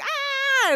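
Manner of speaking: high-pitched imitation scream; mock scream. Host retells man in ad diving into muddy bed, mock scream.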